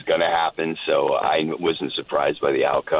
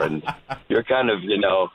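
usual speaking voice

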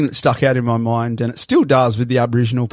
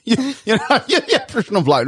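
laughing; 10 semitones higher than usual. Real, not acted. Laughing at his own joke.

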